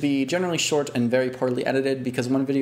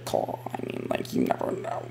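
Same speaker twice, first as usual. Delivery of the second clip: whisper; 14 semitones lower, 6 dB quieter than usual. Real, not acted. Teasing about future projects.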